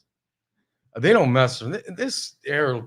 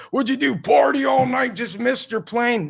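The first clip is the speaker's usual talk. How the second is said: high, loud mocking boss yelling; 12 semitones higher, 5 dB louder than usual. CEO yelling at his employee over the phone: loud mocking rant. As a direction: bark accusations as the angry CEO, much higher. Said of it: "getting yelled at by the C E O".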